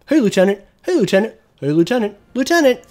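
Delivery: high, loud mock NPC calling lieutenant; mocking game NPCs. Streamer mocking the ship crew's lieutenant spam, high and loud parody. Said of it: "annoying as hell having to hear fucking".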